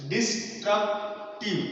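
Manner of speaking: "Destructive" slowly as des, truc, tive; syllable by syllable. Shown as a demonstration. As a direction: break "Destructive" into three separate syllables. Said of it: "there are more than two syllable".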